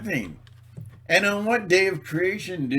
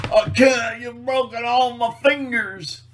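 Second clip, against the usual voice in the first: high, louder giving in; mock broken prisoner; 9 semitones higher, 6 dB louder than usual. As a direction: give up in mock pain. Acting the tortured prisoner.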